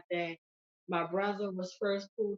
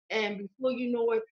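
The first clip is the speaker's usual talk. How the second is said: normal talking voice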